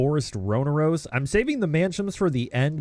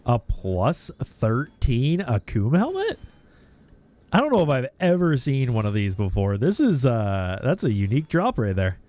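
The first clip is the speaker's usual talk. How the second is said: ordinary delivery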